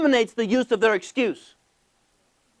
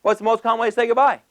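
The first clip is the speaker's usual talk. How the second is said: ordinary talk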